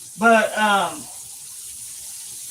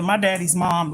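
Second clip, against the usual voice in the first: usual speaking voice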